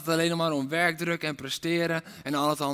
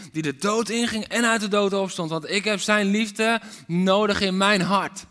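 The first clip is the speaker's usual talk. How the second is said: forceful preaching; 3 semitones higher, 6 dB louder than usual. Acted. Preacher urging a decision, loud and fervent. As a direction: preach it with rising fire.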